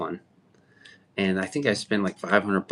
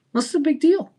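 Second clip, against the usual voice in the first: mock high voice; 3 dB louder than usual. Repeat of the mock reply, higher.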